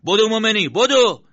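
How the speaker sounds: high, full-voiced yell; very loud, high-pitched urgent command; urgent shout. A narrator's reading. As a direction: shout at a comrade to run for it. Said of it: "بعد مرتضی داد زد".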